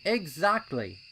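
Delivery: high, loud, impatient colt voice; a keen little colt itching to go; eager young colt. A narrator's reading. Pipsqueak urging his friends at the trail, high and loud.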